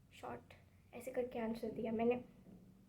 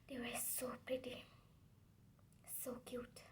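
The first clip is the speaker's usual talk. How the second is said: awed whisper; 11 dB quieter, much slower than usual. Real, not acted. Whispering to viewers about the pretty rivals. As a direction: whisper it like a secret.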